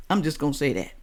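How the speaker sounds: ordinary delivery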